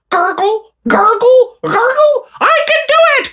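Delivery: high squeaky cartoon mouse; Mickey Mouse voice. Mickey Mouse cheering up Goofy about the ice pick, high and squeaky. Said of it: "I'm Mickey Mouse".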